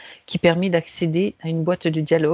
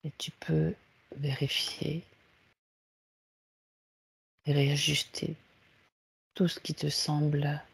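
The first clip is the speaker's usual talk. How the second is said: guided meditation; 3 semitones lower, 7 dB quieter than usual. Acted. Leads a guided relaxation. (voix douce de méditation guidée)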